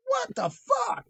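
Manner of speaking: high-pitched, astonished exclamation; shocked disbelief. Retold or imitated. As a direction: blurt it out, scandalized. Shocked at kid's insult.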